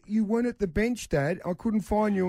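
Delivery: put-on high little-boy voice; child explaining. Son telling dad he missed him, childlike.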